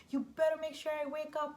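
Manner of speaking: high-pitched retold nervous warning; scared demand. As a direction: act out a frightened patient's demand. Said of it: "I was, like, very scared".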